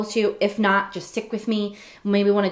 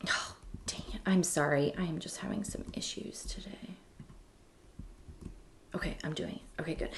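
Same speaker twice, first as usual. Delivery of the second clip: very quiet, half-whispered aside; real low mutter, barely voiced; muttering to herself; 4 semitones lower, 14 dB quieter than usual. Real, not acted. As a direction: mumble it under your breath while fixing a glitch. Muttering to herself over technical trouble.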